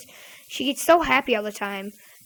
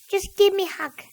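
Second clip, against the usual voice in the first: high-pitched kid imitation; cousin's pestering voice; 7 semitones higher than usual. Cousin wakes him for a hug, pestering kid voice. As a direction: plead like a persistent little cousin. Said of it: "said in that same voice".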